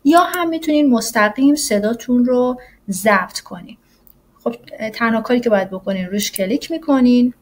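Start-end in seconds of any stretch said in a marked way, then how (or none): none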